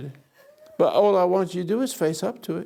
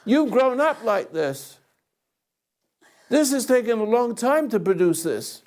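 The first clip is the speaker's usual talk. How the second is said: high, raised and forceful; confronting; 7 semitones higher than usual. Real, not acted. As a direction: confront her head on.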